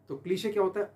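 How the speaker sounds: ordinary delivery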